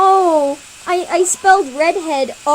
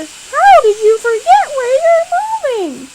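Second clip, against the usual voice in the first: high, loud cat voice; 12 semitones higher, 10 dB louder than usual. Acted. Cat questioning the kids, high and loud.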